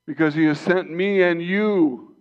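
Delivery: high, loud, soaring preacher's call; rising fervent sermon voice; full-force preaching. Acted. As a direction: lift the room like a revival pulpit.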